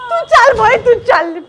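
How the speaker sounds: exasperated shout. Acted. Teacher shoos drama student.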